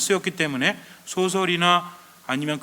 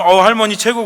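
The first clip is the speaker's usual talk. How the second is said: loud, higher-pitched acted cheer; excited praise; 6 semitones higher, 11 dB louder than usual. Traveller to a grandmother carrying loads, loud excited praise. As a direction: call out admiring praise, high and loud.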